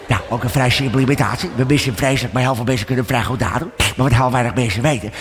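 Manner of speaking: deep relaxed imitation of a football legend; Johan Cruijff impression. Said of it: "In het midden zit Johan Cruijff".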